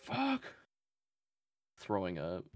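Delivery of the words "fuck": high-pitched mock scream, kept soft; retold scream. Retelling how everyone was screaming, mock scream. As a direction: squeal it high without real volume. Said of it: "Everyone was, like, screaming".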